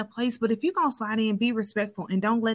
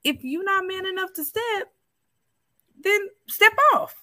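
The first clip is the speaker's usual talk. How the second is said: high mock scold; 10 semitones higher than usual. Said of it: "And I was like".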